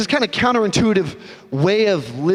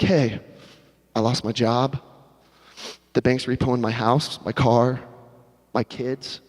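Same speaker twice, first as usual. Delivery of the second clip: low mournful; 7 semitones lower, 3 dB quieter than usual. Acted. Friend sharing job loss.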